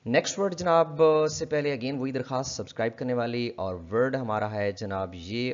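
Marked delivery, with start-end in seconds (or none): none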